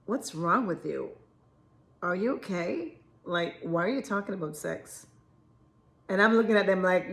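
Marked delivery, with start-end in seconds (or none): 0.0-5.1 low, hushed disapproval; judgmental onlookers. Retold or imitated. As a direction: imitate people quietly questioning you, taken aback. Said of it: "they look at you like".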